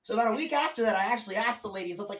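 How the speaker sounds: usual speaking voice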